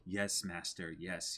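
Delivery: soft, cowering yes master; hunchback assistant. Retold or imitated. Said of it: "and he would go".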